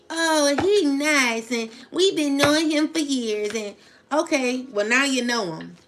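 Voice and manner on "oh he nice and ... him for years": mocking imitation. Mocking the friend's excuse.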